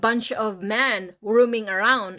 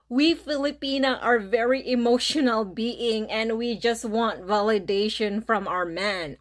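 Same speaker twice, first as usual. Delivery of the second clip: ordinary talk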